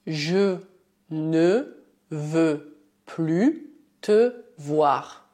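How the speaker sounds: "je ne veux plus te voir" said word by word, very slowly; teacher dictating "je ne veux plus te voir" slowly; slow dictation. Shown as a demonstration. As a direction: spell it out slowly, pausing between words. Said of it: "Por exemplo".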